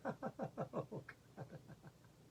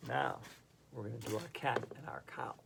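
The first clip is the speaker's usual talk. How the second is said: normal talking voice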